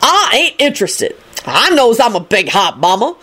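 high, swooping sassy scolding; stern, high-pitched put-down. A narrator's reading. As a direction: scold with haughty, rising insistence. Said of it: "she added sternly".

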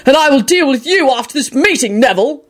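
high, blustering threat; threatening holler. A narrator's reading. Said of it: "Arthur hollered threateningly".

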